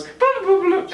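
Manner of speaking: high-pitched imitated robot burbling; playful high droid noises; droid babble. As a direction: burble like a little beeping droid. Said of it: "And then B B eight goes".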